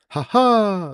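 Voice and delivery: high, loud fake laugh in two beats; Jimmy Carr. Wrestling fan mocking an easily amused crowd, fake Jimmy Carr laugh. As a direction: honk out a put-on laugh. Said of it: "like, we're all Jimmy Carr".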